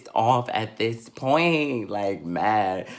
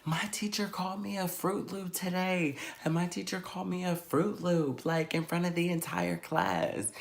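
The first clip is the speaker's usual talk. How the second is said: higher, softer little complaint; tattling child; 7 semitones higher, 6 dB quieter than usual. Retold or imitated. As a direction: imitate a kid telling on the teacher. Child tattling to sleepy mother.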